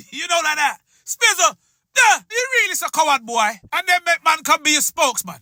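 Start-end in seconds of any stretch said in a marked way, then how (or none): none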